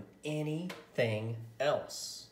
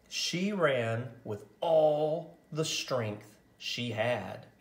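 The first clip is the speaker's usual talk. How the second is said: usual speaking voice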